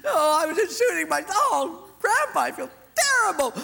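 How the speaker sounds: put-on high, loud crying child; sobbing boy. Boy confessing to grandpa, high sobbing. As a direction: blubber the confession.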